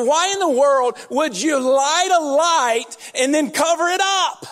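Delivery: mock the idea with disbelief; sarcastic incredulous Jesus. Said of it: "he said it with sarcasm".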